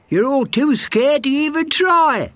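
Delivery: high, bragging hare voice; boastful hare. A narrator's reading. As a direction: brag like the cocky hare. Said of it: "said the hare".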